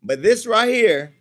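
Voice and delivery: slow, loud, punchy this right here; slow loud emphasis; emphatic. Real, not acted. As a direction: lean hard on each word. Showing tiny ring size.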